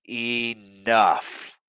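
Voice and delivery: booming bellow. Acted. Santa silencing the quarreling elves, booming bellow. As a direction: cut them off with one booming word. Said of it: "the old man's voice bellowed".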